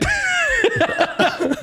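shrieking burst of laughter; real laugh shouted high. Real, not acted. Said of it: "big laugh".

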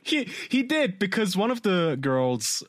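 high laughing voice. Real, not acted. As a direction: laugh the words out. Laughing at a scandal.

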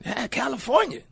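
high-pitched imitated lawman; mock sheriff voice; small-town sheriff. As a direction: toss it out high, like a local sheriff. Acting out exchange with sheriff.

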